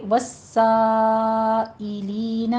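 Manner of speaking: والسائلین slowly, saa held very long; long steady "saa", then "i-leena" in والسائلین; five-count madd. Shown as a demonstration. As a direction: chant والسائلین with a stretched aa before hamza. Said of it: "سا کے اوپر five count کریں گے".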